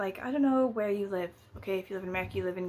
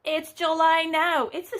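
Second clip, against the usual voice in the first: mock dramatic reveal; 8 semitones higher, 6 dB louder than usual.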